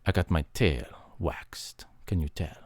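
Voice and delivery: soft secret whisper; mock secret whisper. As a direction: whisper the confession. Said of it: "whispered joke".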